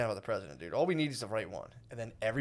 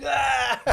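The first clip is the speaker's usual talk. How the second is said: sudden high yell; mock scream; 12 semitones higher, 6 dB louder than usual. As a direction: let out a wild scream. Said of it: "Just be like".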